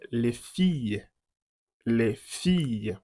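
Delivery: slow, careful model of "filles" with a y glide; "les filles" drawn out as lay FEE-y; French pronunciation. Shown as a demonstration.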